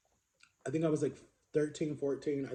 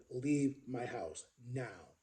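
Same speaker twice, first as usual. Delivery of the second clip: slow, softer imitation of dad's command; retold stern order; 3 semitones lower, 8 dB quieter, much slower than usual. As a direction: give dad's order word by word, quietly firm. Dad orders brother out by phone.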